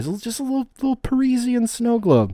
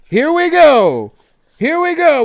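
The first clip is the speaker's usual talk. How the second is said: hype build-up; 6 semitones higher, 6 dB louder, slower than usual. Real, not acted. Opening gift on stream, hyping up.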